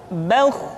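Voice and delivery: "bauh" with a thick, rounded vowel before throaty kh; thick heavy kh. Shown as a demonstration. Teacher showing the correct kha, "bauh" said thick. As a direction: thicken "bauh" toward an o colour. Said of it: "dengan suara yang tebal".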